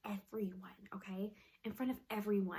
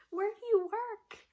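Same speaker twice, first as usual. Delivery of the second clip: high, louder nurse question; lightly voiced nurse; 11 semitones higher, 6 dB louder than usual. Nurse distracting crying patient, high questioning voice. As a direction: imitate a nurse making conversation.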